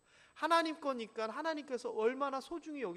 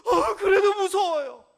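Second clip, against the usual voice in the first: higher, louder, slow whine; mock scared whine; 9 semitones higher, 15 dB louder, slower than usual. Believer keeps whining to God about fear. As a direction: keep whining stubbornly.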